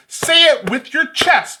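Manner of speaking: loud, slow, emphatic urging; say it with chest. Acted. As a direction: belt it out from the chest, high and slow.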